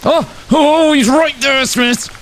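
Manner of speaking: high alarmed shouting; scared shout. Real, not acted. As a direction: warn the team in panic. Spots Bigfoot in camp.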